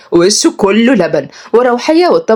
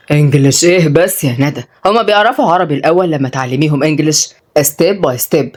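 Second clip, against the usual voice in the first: low male teacher voice; sceptical young man; 4 semitones lower than usual. Acted. Tutor argues for Arabic before English, low and sceptical. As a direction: reason low and patiently. Uday objects to English lessons.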